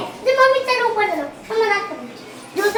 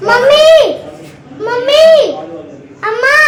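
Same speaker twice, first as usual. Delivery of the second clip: loud, higher-pitched call for attention; 4 semitones higher, 6 dB louder than usual. Real, not acted. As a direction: call out to get mummy back. Calling the aunt back. (पुकारते हुए, ऊँची आवाज़)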